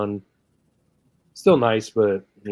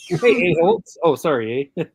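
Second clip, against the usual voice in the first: high, loud jokey Canadian apology; mock Canadian; 6 semitones higher, 5 dB louder than usual. Acting out a Canadian apologizing after an insult. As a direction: play a polite Canadian saying sorry, eh. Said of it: "Call somebody an a-hole".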